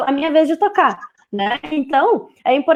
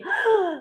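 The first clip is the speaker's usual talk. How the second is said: gasp; 9 semitones higher, 5 dB quieter than usual. Shown as a demonstration. Pausing song, suspense for babies.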